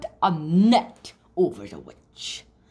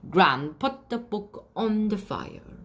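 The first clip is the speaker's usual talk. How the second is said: usual speaking voice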